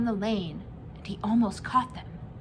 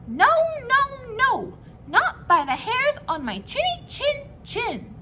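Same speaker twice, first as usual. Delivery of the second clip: high, loud squeaky little pig; 5 dB louder than usual. A narrator's reading. Frightened little pig shouting back at the wolf, high and loud. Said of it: "so he answered back".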